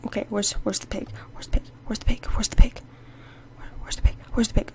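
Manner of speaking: unvoiced searching whisper. Real, not acted. Gamer searching for the pig, whispering. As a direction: hunt for it in a hush.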